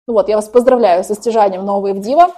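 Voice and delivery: ordinary delivery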